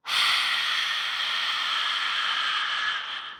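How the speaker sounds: long, breathy exhale; deep sigh. Shown as a demonstration. Guide showing listeners how to exhale, deep audible sigh. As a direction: let a deep breath all the way out. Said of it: "Then with a deep sigh out".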